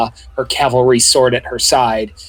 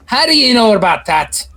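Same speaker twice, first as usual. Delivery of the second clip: a hint of Scottish accent, high; suspicious question in a light Scottish accent; 7 semitones higher than usual. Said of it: "come into a Scottish accent".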